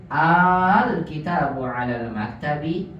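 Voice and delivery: slow recitation with a long "Al"; lengthened Al. Shown as a demonstration. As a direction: open with "Al" held long and drawn out. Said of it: "dipanjangkan. Begini".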